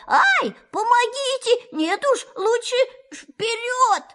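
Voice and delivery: high, squealing call for help; panicked cry. Acted. Stuck Pooh shouts for help, high panicked squeal. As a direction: yelp for help, wedged tight. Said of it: "он закричал".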